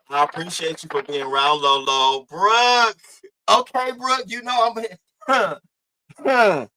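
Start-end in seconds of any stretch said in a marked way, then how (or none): 2.2-6.7 high teasing hello with a laugh; playful laughing greeting. Real, not acted. Greeting Brooke in the chat, laughing. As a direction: tease her name, then laugh.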